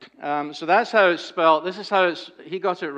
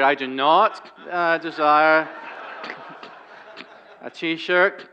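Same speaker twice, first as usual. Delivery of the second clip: "not" and "desire" punched louder; mock-solemn, hit "I do not desire"; "desire" pressed hard and loud, dry joke; emphatic refusal; 3 dB louder, slower than usual. Real, not acted. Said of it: "I want to make it very, very clear".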